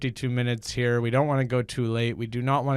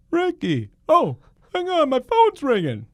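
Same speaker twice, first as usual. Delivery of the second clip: very high, loud, bad impression; hammed-up squeal of a talk-show comedian; Jerry Seinfeld; 9 dB louder than usual. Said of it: "my Jerry Seinfeld is not great".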